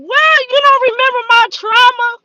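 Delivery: high, loud, fast disbelief; hammed-up outrage, pitched high and loud; mock outrage. Retold outrage at sister forgetting.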